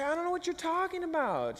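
high-pitched mock older lady; older woman. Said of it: "She's a little bit older".

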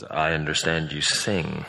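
very deep, slow professor; incredibly low voice. Acted. Professor at the door asking about singing, incredibly low voice. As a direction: sink to the bottom of the range and draw it out. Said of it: "in this incredibly low vo-, low voice".

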